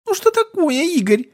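put-on very high childish squeak; squeaky little girl. A narrator's reading. Little girl answering Igor, squeaking. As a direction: pipe up like a tiny child. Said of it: "пропищала мелкая".

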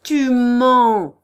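loud, sharp outcry; cry out. Acted. Prince cuts off Chichikov's lie with a loud cry. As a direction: fling the accusation at him. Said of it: "s'écria le prince".

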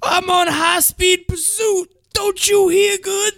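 high, slow shouted sheriff imitation; Buford T. Justice. Sheriff yelling at the other sheriff, high mock shout. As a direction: bellow like an indignant Texas sheriff. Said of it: "He's like".

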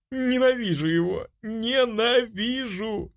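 high, drawn-out anguished cry; high, sobbing fury; tearful hatred. A narrator's reading. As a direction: wail it through burning tears, stretching the words. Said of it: "Жгучие слёзы сами текли из моих глаз".

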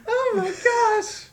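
squealing, laughing high-pitched joy; overjoyed laughing squeal, way up high; tearful squealing yes. Pretend. Mock accepting proposal, squealing.

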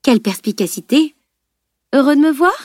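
mock admiration, then a high rising question; sarcastic retort. A narrator's reading. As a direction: needle the stunned parents. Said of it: "rétorqua-t-elle".

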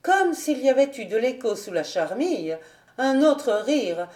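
ordinary delivery

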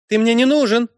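very high, sharp outcry; high-pitched girlish rejection; girl's cry. A narrator's reading. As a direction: cry it out as an angry girl. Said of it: "выкрикнула девушка".

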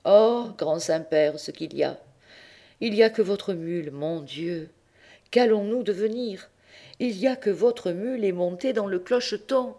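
high, hammed-up lament of a sly boy; fake wailing. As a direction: pretend to weep to the pope. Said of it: "faisant mine de pleurer". (Tistet fait mine de pleurer devant le pape, voix aiguë et geignarde)

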